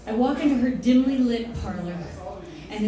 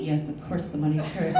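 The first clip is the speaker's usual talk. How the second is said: dismissive psychic; 8 semitones lower, 5 dB quieter than usual. Acted. Psychic answers, low dismissive.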